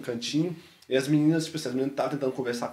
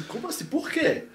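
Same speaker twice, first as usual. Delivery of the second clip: much higher, incredulous why; astonished questions. Retold or imitated. Retelling his shock at a stranger's gift, much higher disbelief. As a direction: pitch jumps in disbelief. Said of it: "Sabe aquelas perguntas?".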